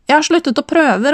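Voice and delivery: high-pitched call from the next room; lightly acted call across the flat. A narrator's reading. Woman calling from the living room to the kitchen, calling out. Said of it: "ropte hun fra stua".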